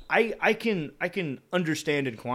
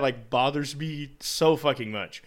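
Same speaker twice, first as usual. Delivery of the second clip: ordinary talk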